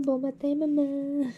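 cat greeting mom. Pretend. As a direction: coo it like a happy kitten waking you. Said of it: "elle est comme, ah".

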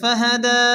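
فهدى with the دى held one beat; lengthened da. Shown as a demonstration. Teacher showing students the lengthened ending. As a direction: stretch the end of فهدى for one alif. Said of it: "এক আলিফ টেনে পড়তে হবে".

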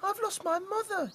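high squeaky chick voice; cheeping chick. Acted. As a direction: peep it like a lost chick. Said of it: "cheeped little chick".

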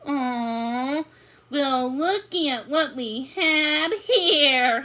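slow, sly teasing drawl. A narrator's reading. Uncle slyly teasing the puppies, slow and sly. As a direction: tease the pups, sly and slow. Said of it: "he said teasingly".

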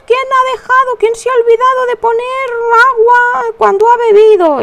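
high-pitched put-upon scolding of the family; hammed-up grumble at whoever forgot the water; exasperated mock complaint.

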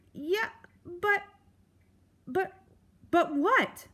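high stuttering mouse voice; terrified stammer. A narrator's reading. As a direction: stutter in panic.

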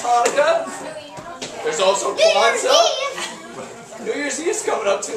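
ordinary delivery

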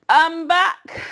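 high, loud husky rasp; husky rat voice. Acted. The rat Templeton crawls back after his feast, husky. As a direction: croak it out like a worn-out rat. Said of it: "he said in a husky voice".

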